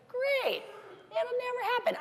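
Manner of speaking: high, dry mock cheer hiding doubt; sarcastic inner thought. To herself, doubting the star's triathlon plan: high sarcastic cheer. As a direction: think it, don't say it: great, never happen. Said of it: "I never said that".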